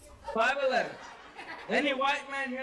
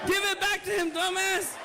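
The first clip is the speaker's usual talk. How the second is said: high-pitched raised-voice order; scolding call-out; 8 semitones higher than usual. Real, not acted. Comic ordering audience member to return a leg, scolding yell. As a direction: yell at a heckler to hand it back.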